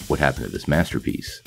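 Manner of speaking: ordinary talk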